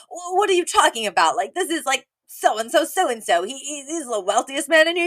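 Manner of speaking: imitating a man stunned by who is at the door; hammed-up astonished retelling; incredulous Sheffield. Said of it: "And then Mister Sheffield's like".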